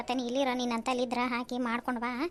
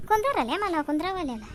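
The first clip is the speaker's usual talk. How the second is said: insistent call; 6 semitones higher, 5 dB louder than usual. Acted. Host urging guest to sit.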